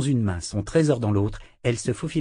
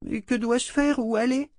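put-on high child voice, anxious questions; a girl asking what to do; lost little girl; 13 semitones higher than usual. A narrator's reading. Rachel perdue. (voix de fillette inquiète)

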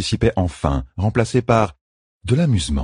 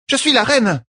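higher, indignant outburst; furious cry; 3 dB louder than usual. A narrator's reading. Queen shouts at midwife, furious. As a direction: pull rank in anger. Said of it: "s'écria Elaine, furieuse".

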